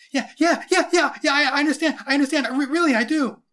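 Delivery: high, loud, frantic stammer; terrified kid gushing assent; panicked agreement. A narrator's reading. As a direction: babble it out to save your skin. Terrified boy agrees in story.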